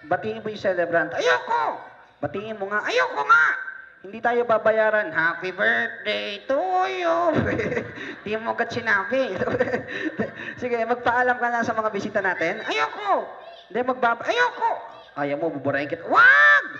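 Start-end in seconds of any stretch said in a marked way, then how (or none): 1.2-1.8 shouted refusal. Acted. Puppet refuses again. 2.9-3.5 high, loud stubborn no; shouted refusal. Acted. Puppet refuses a third time. 12.7-14.7 shouted refusal. Acted. Refuses to say goodbye. 16.1-16.7 high, loud frightened cry; panicked scream. Acted. Threatened with deletion.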